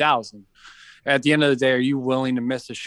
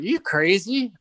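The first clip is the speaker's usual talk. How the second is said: higher-pitched retold disbelief; mock-incredulous question, pitched up; 8 semitones higher, slower than usual. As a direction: quote a friend asking in disbelief. Retold reaction to thrown tripod.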